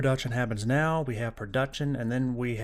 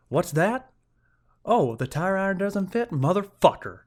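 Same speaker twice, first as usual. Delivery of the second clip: higher and louder, mock outrage building to a swear; retold exasperation; 8 semitones higher, 6 dB louder than usual. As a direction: replay the moment the tool won't fit, hammed-up. Retold discovering tire iron fails.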